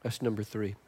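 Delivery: normal talking voice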